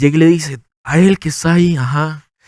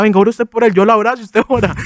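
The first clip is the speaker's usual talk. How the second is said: eager call; 6 semitones higher than usual. Retold or imitated. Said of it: "Y yo llamaba a alguien que orara".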